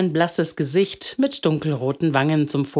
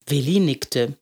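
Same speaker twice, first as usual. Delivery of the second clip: usual speaking voice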